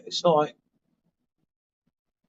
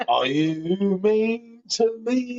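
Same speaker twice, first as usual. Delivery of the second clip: slow, higher, whiny pleading; mock hurt whine; 6 semitones higher, much slower than usual. Husband mocking his wife's teasing, in a slow hurt whine. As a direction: play the wounded husband, dragging it out.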